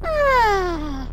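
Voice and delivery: high reverb-drenched vision voice; vintage echo chamber. Acted. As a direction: sing it out high through heavy echo. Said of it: "Sorry, a little too much reverb".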